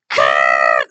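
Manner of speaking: loud, low imitated blast; harsh noise burst. Imitated noise as something happens.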